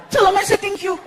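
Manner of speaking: high-pitched eager thanks; Frankie. Acted. Frankie grateful for cake, high-pitched voice. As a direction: pipe up in a put-on high voice. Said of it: "Frankie walks into the kitchen".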